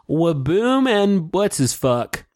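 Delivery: mock show host. Imagining the reunion's opening, mock host voice. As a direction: parody an upbeat TV intro. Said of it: "imagine if they open it up like".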